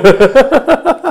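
bursting out laughing; a big high laugh at his own line; loud high laugh. Real, not acted. Teller laughing at his own acted curse, loud high laugh.

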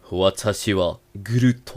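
low, slow villain growl in Japanese; hammed-up anime baddie. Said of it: "a minha maior voz de vilão de anime". (voz de vilão de anime, lenta e grave)

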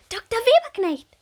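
loud, high excited call; excited little bumblebee. Acted. Bumblebee Bommel rushing in to the doctor, high excited call. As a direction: burst in eagerly. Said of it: "sagt Bommel aufgeregt".

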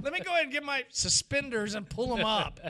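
mock a fussy nerd hitching up his suspenders; exaggerated geeky caricature.

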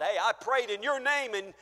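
reminding God who you are, insistent; pleading believer. Acted. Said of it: "we may say that to God".

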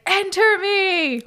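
high, slow, drawn-out plea; mock solemn surrender; hammed-up invitation. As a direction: stretch it out like a dramatic offering. Retelling character inviting ghosts.

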